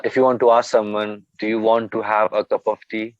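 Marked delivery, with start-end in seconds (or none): 1.4-3.1 Indian English, each and every word pronounced; fully sounded Indian-accented question; raw Indian accent. Shown as a demonstration. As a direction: say it in plain Indian accent, words kept separate. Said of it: "This is raw Indian accent".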